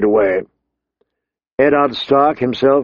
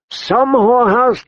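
much higher, slow, indignant echo; outraged disbelief; 11 semitones higher than usual. A narrator's reading. Ned angrily echoing the king's insult, outraged disbelief. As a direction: fling the word back in outrage.

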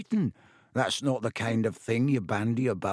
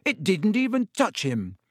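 angry; 7 semitones higher, 3 dB louder than usual. A narrator's reading. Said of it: "his voice shaking with anger".

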